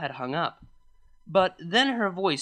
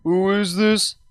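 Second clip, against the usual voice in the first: male voice; 4 semitones higher, 6 dB louder, slower than usual. Acted. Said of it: "a male voice said".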